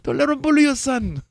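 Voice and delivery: high, loud imitation of a fight coach; corner man impression. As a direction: holler it like a cornerman firing up his fighter. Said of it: "kept saying that over and over".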